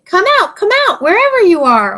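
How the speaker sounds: high, mock wolf call; wolf shouting. Goat retelling the wolf's call to Little Bear, mock wolf shout. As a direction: call out like a menacing wolf, playfully. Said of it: "and he shouted".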